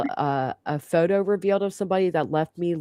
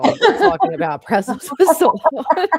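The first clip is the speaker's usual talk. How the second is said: high, giggling through the words; laughing; 10 semitones higher, 7 dB louder than usual. Real, not acted. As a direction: laugh it out while teasing. Laughing while teasing friend.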